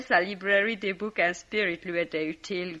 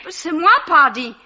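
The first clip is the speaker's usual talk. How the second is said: high, loud, laughing hysterics; hysterical outburst; 12 semitones higher, 10 dB louder than usual. Acted. Drunk woman shrieking at the visitors that all will accuse her. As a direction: shriek it out, half laughing, fast.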